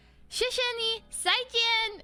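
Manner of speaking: high-pitched, showy farewell; imitating Chinese-speaking employee. Bank man surprising her in Chinese at the end, high and eager. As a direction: send the customer off in high, eager Mandarin. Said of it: "he spoke, like, better Chinese".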